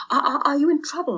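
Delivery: stammering worry. Acted. Hans stammering to Peter.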